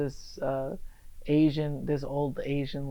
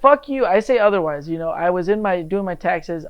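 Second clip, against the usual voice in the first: loud, high-pitched indignation easing off; indignant comeback; 4 semitones higher, 10 dB louder than usual. Real, not acted. Guest told he's not attractive, loudly indignant. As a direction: burst in loudly to defend yourself. Mock quarrel over being called unattractive.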